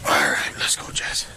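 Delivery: low whisper; hushed, under the breath. Real, not acted. Officer speaks low during arrest.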